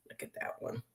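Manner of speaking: whispered admiration; hushed aside. Real, not acted. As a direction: whisper it to yourself.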